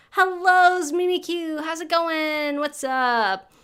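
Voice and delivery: loud, hyped YouTuber greeting; bright energetic channel host voice; clickbait YouTuber voice. Pretend. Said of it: "That, that's my YouTuber voice".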